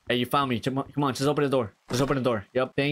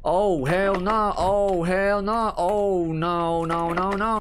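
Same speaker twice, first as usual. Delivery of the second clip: slow, higher, stretched hell nah; drawn-out dread; 6 semitones higher, 3 dB louder, much slower than usual. Real, not acted. Gamer seeing something scary, slow high dread. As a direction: drag it out in disbelief. Dread at horror game scene.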